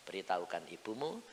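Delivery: low, hushed divine instruction; suara Tuhan. Acted. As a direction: deliver it softly and calmly. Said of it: "Tuhan ngomong sama saya". (suara Tuhan yang pelan dan rendah)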